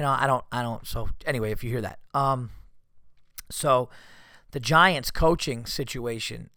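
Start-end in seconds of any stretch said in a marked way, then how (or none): none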